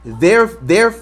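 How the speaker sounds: usual speaking voice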